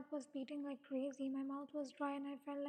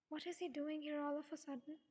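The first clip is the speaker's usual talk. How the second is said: ordinary delivery, nothing marked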